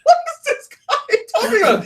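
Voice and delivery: very high, laughing disbelief; mock bafflement. Designer baffled by his boss's odd remark, hammed-up disbelief. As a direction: squeal it as a baffled, exasperated quote.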